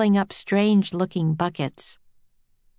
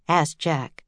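ordinary delivery

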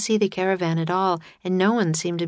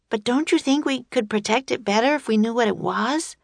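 put-on higher-pitched, coaxing child's voice; eager girl Annie; 6 semitones higher than usual. Annie persuades Jack to open the box, coaxing. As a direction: coax in a high voice, rising to the question.

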